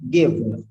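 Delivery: "given" said "GIV-nuh"; V straight into N. Shown as a demonstration. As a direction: drop the vowel between V and N in "given". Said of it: "V, immediately na".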